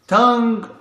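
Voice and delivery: tongue said high and held; high repeat. Shown as a demonstration.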